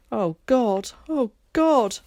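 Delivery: mother breaking down on the stair; anguished. A narrator's reading. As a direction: cry out in despair. Mum sobbing on stair.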